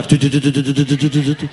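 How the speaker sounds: low chopping rotor sound; helicopter noise. Acted.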